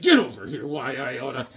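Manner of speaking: mock tough-guy threat; comic scrapper's growl; brawling Hawkman. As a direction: play a cartoon brawler mid-punch. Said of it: "He's like".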